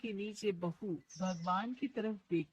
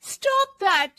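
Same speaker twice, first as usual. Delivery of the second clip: higher, louder angry cry; angry shout; 9 semitones higher, 12 dB louder than usual. Acted. Daughter-in-law angrily shouts the game words at mother-in-law. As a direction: fling the words at her in anger. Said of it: "जोर से गुस्से से बोल".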